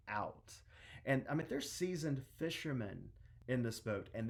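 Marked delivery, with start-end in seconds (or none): none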